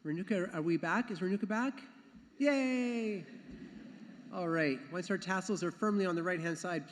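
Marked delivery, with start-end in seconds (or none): 2.4-3.2 high, drawn-out yay; long high-pitched cheer that slowly falls; happy cheer. Real, not acted. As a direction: cheer someone's arrival. Cheering the awaited person's return.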